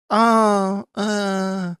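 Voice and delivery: high, drawn-out fake whine; mock whimpering. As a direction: moan as if about to cry, hammed up. Mock fretting about getting upset.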